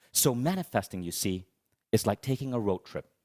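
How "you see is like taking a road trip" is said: ordinary talk, nothing marked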